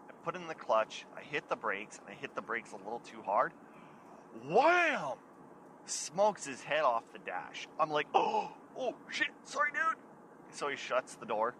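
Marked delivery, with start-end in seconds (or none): 4.4-5.2 sudden shouted wham, pitched way up; loud wham. Retold or imitated. Telling friends how the brakes slammed, shouted wham. Brakes slam, head hits dash. 8.1-10.0 startled apology. Retold or imitated. Apologising after head hits dash.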